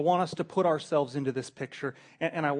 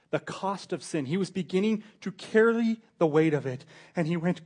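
ordinary talk

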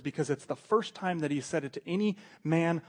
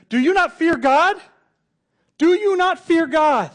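much higher, loud, shouted challenge; yelled rebuke; 14 semitones higher, 15 dB louder than usual. Acted. Thief yells at the other mocking thief, loud rebuke. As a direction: yell it across at him. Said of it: "he yells over to the other".

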